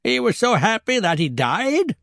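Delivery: high, disbelieving question rising on "died"; incredulous echo. Acted. Grandmother questioning the absurd death, incredulous echo. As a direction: repeat it back in high-pitched disbelief.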